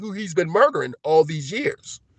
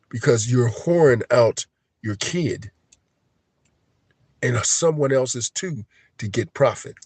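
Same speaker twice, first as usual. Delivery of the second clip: normal talking voice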